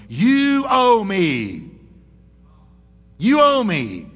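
loud, slow entitled demand. Retold or imitated. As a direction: hammer the demand out slowly. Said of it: "his attitude was".

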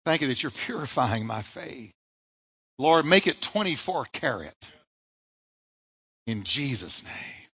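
soft prayer. Shown as a demonstration. Leading the church in prayer, hushed and tender. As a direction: pray it gently, hushed. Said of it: "lift your hand and say".

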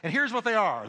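ordinary talk

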